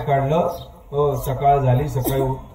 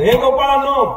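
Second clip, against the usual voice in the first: put-on high, loud call to the cowherd boys; 9 semitones higher, 5 dB louder than usual. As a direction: call the boys over, higher and louder. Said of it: "Krishna म्हणतो".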